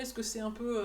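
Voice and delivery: normal talking voice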